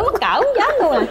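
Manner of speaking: bashful giggly squeal; embarrassed laugh. Retold or imitated. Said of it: "con nói".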